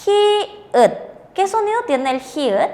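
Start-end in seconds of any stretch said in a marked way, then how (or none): 0.1-0.9 "hi읗" high, syllable by syllable; letter name sounded out. Shown as a demonstration. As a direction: model "hi읗" in a raised, careful voice. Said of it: "Vemos la consonante". ("hi읗" agudo, sílaba por sílaba)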